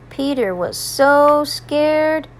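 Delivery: ordinary delivery, nothing marked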